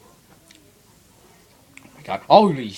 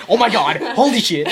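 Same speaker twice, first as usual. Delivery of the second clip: shocked exclaim; 10 semitones higher, 8 dB louder than usual. Real, not acted. Startled reaction, loud.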